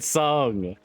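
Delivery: ordinary talk, nothing marked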